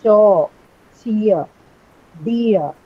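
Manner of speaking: teacher demo with R left silent; no R sound. Shown as a demonstration. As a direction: cheer and dear end on the vowel, no R. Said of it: "You don't articulate the R sound".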